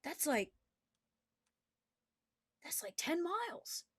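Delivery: curious soldier, hushed. Acted. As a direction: peer over the edge and wonder aloud, hushed. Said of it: "he goes".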